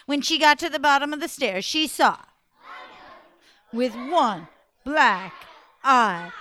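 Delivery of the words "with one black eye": slow, drawn-out spooky build to the last word; suspenseful reveal. A narrator's reading. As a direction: hold back and land it heavily.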